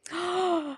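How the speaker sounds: sharp, high delighted gasp; an imitated gasp of awe. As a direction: gasp in wide-eyed wonder. Date gasps in amazement.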